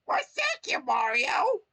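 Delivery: very high, loud squeaky thanks; grateful Toad. Acted.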